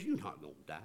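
very low, hushed devil's voice; sly, quiet aside from the devil; tempting devil. Acted. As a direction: slip in the lie softly, as the tempter. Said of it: "But what he says is".

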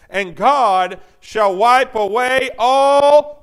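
very slow, drawn out, then stop; slow build-up. Shown as a demonstration. Preacher pausing for the congregation to fill in, very slow. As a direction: stretch it out and leave it hanging.

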